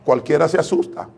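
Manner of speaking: ordinary talk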